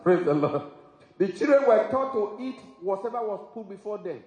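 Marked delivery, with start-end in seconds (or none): none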